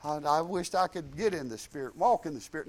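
lightly voiced whiny doubter; whiny doubting voice. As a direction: mope it like you can't get there. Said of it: "Satan's gonna tell you".

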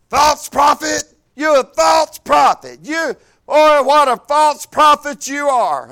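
imitating his mother's furious yelling; mother's angry shout. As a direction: throw the accusation at him. Mother shouting at her son.

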